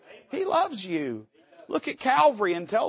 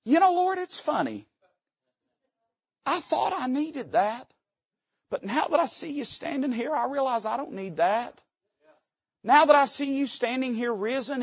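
soft humbled Thomas; 5 semitones higher, 3 dB quieter than usual. Acted. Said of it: "Thomas all of a sudden says".

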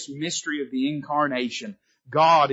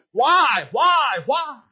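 much higher, loud cry fading; crying why; 9 semitones higher, 4 dB louder, much slower than usual. Acted. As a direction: cry out the question, then let it fall. Echoing Jesus' question at Calvary.

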